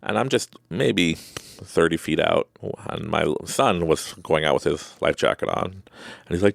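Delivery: ordinary talk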